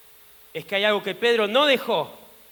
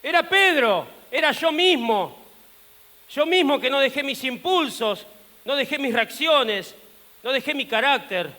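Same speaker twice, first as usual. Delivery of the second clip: raised, forceful confession; emphatic self-accusation; 4 semitones higher, 4 dB louder than usual. Acted. Peter names himself his worst enemy, loudly self-accusing. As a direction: hit each admission hard, pitched up.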